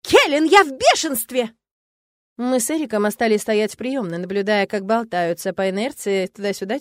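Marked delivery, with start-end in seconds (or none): 0.0-1.5 loud, high, slow outburst; furious bark. A narrator's reading. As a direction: announce rage at full voice. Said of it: "рявкнула Шарти".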